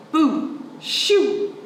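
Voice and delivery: high, loud boo shoo. Acted. Child shooing a bear off the porch, loud and high.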